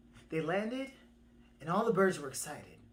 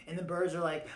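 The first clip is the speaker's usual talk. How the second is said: usual speaking voice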